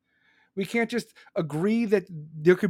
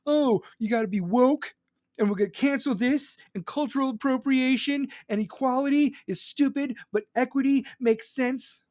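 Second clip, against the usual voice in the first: higher-pitched put-on activist voice; mocking woke activist; 7 semitones higher than usual. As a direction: parody their slogans up in a higher register. Mocking woke cancel culture.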